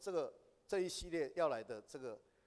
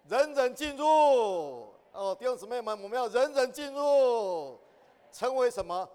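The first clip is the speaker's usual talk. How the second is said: higher, louder and slow, calling everyone in; rallying shout; 8 semitones higher, 12 dB louder, slower than usual. Real, not acted. Preacher urges the brothers and sisters, shouting slowly. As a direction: shout it out to rally the whole room.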